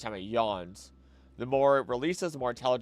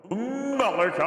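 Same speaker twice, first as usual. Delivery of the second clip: excited; 12 semitones higher, 5 dB louder, slower than usual. Real, not acted. Excited streamer reading donor name.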